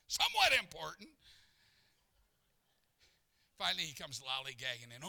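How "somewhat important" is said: suddenly louder, higher self-important protest; mock self-importance. Preacher recalling being kept waiting, mock self-important. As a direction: puff it up with sudden volume.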